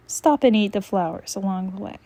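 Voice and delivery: ordinary talk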